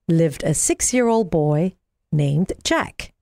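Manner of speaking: ordinary delivery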